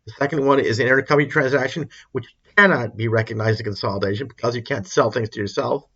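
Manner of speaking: ordinary talk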